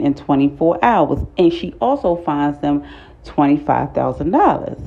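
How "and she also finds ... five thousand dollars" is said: normal talking voice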